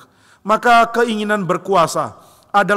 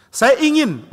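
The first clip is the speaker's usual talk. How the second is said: lightly voiced child, higher pitch; a hint of a child's stubborn demand; insistent child; 7 semitones higher, 4 dB louder, slower than usual.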